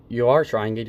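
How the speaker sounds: ordinary talk, nothing marked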